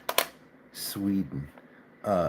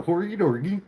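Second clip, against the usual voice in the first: high, lilting mock-Swedish gibberish; sing-song cartoon chef nonsense, pitched way up; 10 semitones higher, 3 dB louder than usual. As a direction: do a silly high Swedish Muppet babble. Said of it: "so you don't sound like the".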